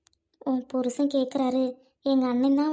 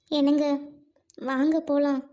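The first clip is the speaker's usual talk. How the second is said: ordinary talk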